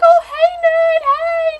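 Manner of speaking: high, loud mock-cheery hello; cheerful greeting. Friends spot Ned at the castle, high bright greeting. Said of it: "they're like".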